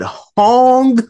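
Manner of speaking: loud, very high-pitched mimicry; exaggerated disbelieving imitation; mocking incredulous quote. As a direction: blurt the foolish answer high and loud. Mocking a character's foolish answer.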